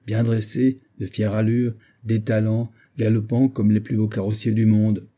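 ordinary delivery

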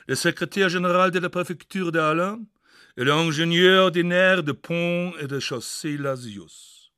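pompous French declamation; grand French reading. Acted. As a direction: proclaim the title like an old official. Reading grand French map title.

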